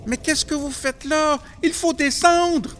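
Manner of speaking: high desperate yell; shouting. Acted. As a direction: scream it at the brothers in panic. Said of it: "Je me mis à crier". (cri aigu et désespéré)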